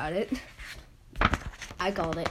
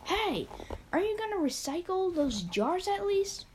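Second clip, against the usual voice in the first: pitched-up calling voice; higher character voice; 11 semitones higher than usual. Acted. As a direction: call out in a higher voice.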